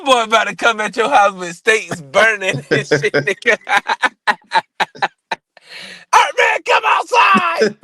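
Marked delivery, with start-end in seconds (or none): 6.1-7.8 mock callout shout. Joking that an angry fan calls him outside, high shout. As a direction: holler it like calling someone out.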